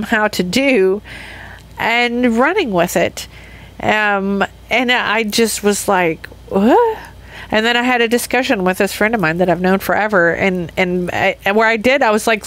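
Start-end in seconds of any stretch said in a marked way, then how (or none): 6.5-7.0 high sharp disbelieving what; retelling her own stunned outburst; shocked what. Retold or imitated. Stunned reaction in retold moment.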